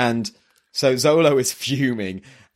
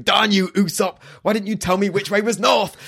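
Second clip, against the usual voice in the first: higher mock-outraged shout; indignant Luffy; 8 semitones higher than usual. Fan quotes Luffy's panel, high mock outrage. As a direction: blame Usopp like a sulky kid. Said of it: "and he's going".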